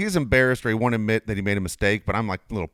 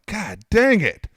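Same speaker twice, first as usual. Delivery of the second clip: exasperated groan; 9 semitones higher, 5 dB louder, much slower than usual. Retold or imitated. Retold exasperation.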